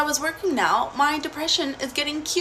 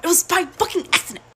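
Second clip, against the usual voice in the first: heated; 7 semitones higher than usual. Real, not acted. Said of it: "this story gets me so heated".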